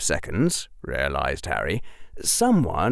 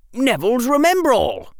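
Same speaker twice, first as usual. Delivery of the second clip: put-on high-pitched boy's cry of recognition; high, eager character voice; excited exclamation; 4 dB louder than usual. A narrator's reading. Boy exclaims at found object.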